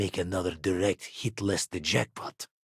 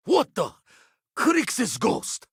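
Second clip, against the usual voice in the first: high-pitched startled yelp of an oath; 12 semitones higher, slower than usual. Acted. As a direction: jump as if caught off guard. Startled, then apologises for distraction.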